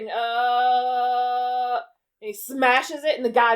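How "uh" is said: long, steady held "uhhh"; drawn-out uhh. Retold or imitated. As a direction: imitate a suspenseful hum with a sustained uh. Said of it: "kind of vibrates his hand over it".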